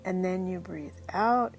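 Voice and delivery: softly guiding breath by breath toward sleepiness; guided hypnosis voice. Acted.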